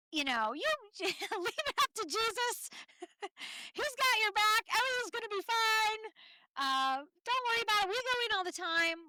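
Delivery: high, loud, fun Southern friend voice; Southern accent. Retold or imitated. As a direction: imitate a breezy Southern woman waving off worry. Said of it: "she talks in a real Southern accent".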